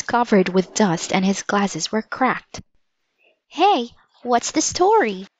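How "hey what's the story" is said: high-pitched, lightly voiced reporter's question; a hint of an inquisitive reporter; newspaper reporter. A narrator's reading. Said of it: "sounded just like the newspaper reporter".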